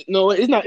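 high loud no, it's not; emphatic correction. Real, not acted. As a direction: snap back the correction.

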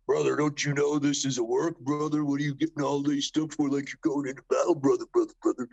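a hint of the old-school wrestler's brother talk; teasing Jake impression. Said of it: "He's like".

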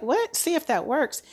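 high, incredulous echo of his words; a sharp disbelieving rise on what; shocked disbelief. Retold or imitated. Wife repeating the doctor's words back, stunned and high. Doctor admits no known treatment.

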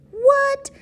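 squeaky high what; mock surprise. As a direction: pretend shock, squealed.

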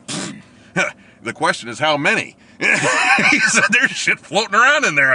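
loud, high laughing retort; laughing paramedic. Retold or imitated. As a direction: laugh out the punchline.

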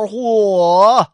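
a sudden, loud 嚯 of shock; shocked exclamation. Acted. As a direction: blurt out startled surprise. Shocked at the exposed neck.

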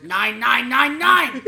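loud, rising mock-German shouts; high, forceful cartoon German; extremely German. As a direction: shout it in a hammed-up German accent. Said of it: "I think extremely German".

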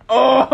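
startled oh. Retold or imitated. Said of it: "It's like".

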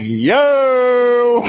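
long, high drawn-out "yo"; stretched, high-pitched shock; stunned exclamation. Retold or imitated. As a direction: cry out high in exaggerated disbelief. Stunned reaction in retold story.

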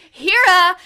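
calling out Hira, loud and high; retold shout. Eiffel calling out for Hira, loudly. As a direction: shout the name into dead comms. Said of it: "And then he goes".

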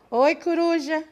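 usual speaking voice

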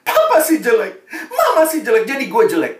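high whiny complaint with a laugh; hammed-up teen whine; mock sulky blaming. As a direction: imitate a kid blaming mom and dad, half laughing. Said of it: "Marahnya papa mama".

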